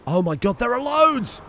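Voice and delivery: high, rising cry of alarm. Real, not acted.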